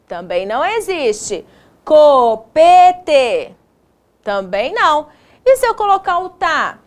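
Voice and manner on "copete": "Copete" in loud, separate syllables; deliberate, syllable-by-syllable "Copete"; sounding out. Shown as a demonstration. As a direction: test "Copete" piece by piece, firmly. Teacher testing made-up word.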